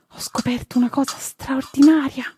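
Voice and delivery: put-on high, excited child's voice; thrilled boy announcing a discovery; excited revelation. A narrator's reading. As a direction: burst out with the secret, pitched right up. Boy reveals his secret to pony.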